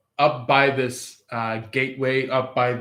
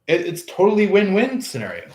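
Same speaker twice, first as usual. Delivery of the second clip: usual speaking voice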